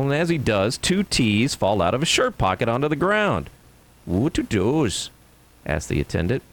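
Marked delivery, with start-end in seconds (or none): 4.0-5.1 slow Irish question. Acted. Attendant asks.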